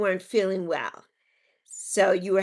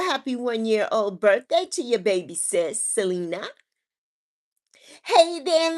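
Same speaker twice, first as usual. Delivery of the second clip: ordinary talk, nothing marked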